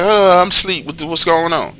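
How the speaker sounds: imitated sleepy partner. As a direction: imitate someone just woken and confused. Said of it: "And she's like".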